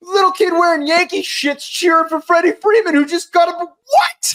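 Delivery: high, fast, worked-up commentary; excited play-by-play yelp. Real, not acted. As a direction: call it like a thrilled fan. Excited reaction to World Series.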